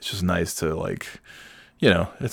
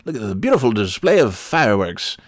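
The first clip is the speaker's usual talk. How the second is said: hammed-up ballgame announcer, a touch higher; baseball announcer; 3 semitones higher than usual. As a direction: call the fireworks like a play-by-play man. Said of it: "pretending that I was a baseball announcer".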